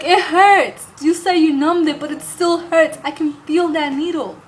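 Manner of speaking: high, loud mock complaint about the pain; retold loud protest. To the doctor who claimed it was numbed, a high loud protest.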